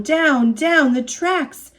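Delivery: usual speaking voice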